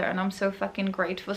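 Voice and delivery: normal talking voice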